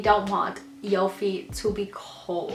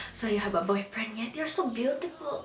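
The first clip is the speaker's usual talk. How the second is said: higher, softer cooing auntie; nosy aunt; 6 semitones higher, 5 dB quieter than usual. Retold or imitated. Aunt at a family event asking about boyfriends, higher cooing voice. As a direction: coo it like a prying aunt. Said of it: "your aunt and your uncle will come up to you and say".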